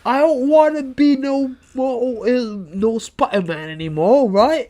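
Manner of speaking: put-on Cockney accent; Tom Holland, Cockney. Co-host posing as British actor quitting Spider-Man, mock Cockney. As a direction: do a hammed-up Cockney. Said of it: "That was a good British Cockney accent".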